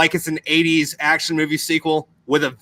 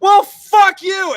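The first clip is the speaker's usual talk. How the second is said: much higher, louder and slow, squealed out; mock shouted insult; 7 dB louder, much slower than usual. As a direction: drag out a hammed-up shrieking comeback. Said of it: "And then the devil's like".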